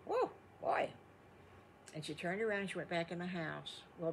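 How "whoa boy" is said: high, airy gasp of a call; Mommy Piggy's exclamation. Acted. Mommy Piggy calls out in a high, breathy gasp. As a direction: blow it out high and breathy, like a startled mother pig. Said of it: "Mommy Piggy goes".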